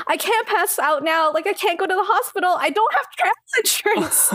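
high-pitched, climbing mock panic; acted freaking out. Fretting about having no travel insurance, comic panic. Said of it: "I'm, like, freaking out".

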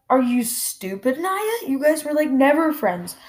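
high-pitched exasperated disbelief; baffled, indignant scolding; incredulous. Real, not acted. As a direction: voice climbing as you call out nonsense. Scolds wrestler for false claim.

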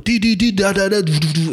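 high rhythmic syllables stepping down; imitated trip pulse. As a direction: mimic the machine starting up.

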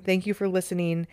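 usual speaking voice

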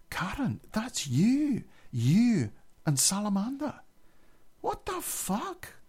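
high-pitched, hammed-up disbelief; mock incredulous. Housemate hearing of his friend's drug exploits, high incredulous imitation. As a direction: imitate him aghast, pitch climbing to a squeal.

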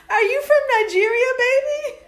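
mock gullible woman. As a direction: coo to the boyfriend.

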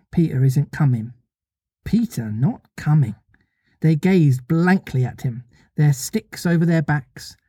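measured, unhurried storybook reading; story narration. A narrator's reading. Reader telling listeners of Peter staying behind, measured story narration. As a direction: read it aloud with deliberate pauses. Reads the novel aloud.

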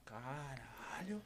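slow, soft, amazed curse; drawn-out hushed wow; awed murmur. Retold or imitated. Retold awed reaction to show.

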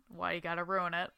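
ordinary talk

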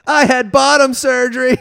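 loud, high-pitched hammed-up declaration; mock indignant shout. Imagined student correcting the teacher, mock indignant shout. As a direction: call it out high and loud as a joke protest.

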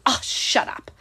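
imitating a rude client's whispered dismissal; whispered retort. A rude client whispers a dismissal to the server. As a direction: breathe it out scornfully under the breath.

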